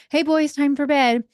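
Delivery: put-on high, loud call to the kids; yelling out. Parent calling boys in the living room, high loud yell. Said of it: "I will just yell out".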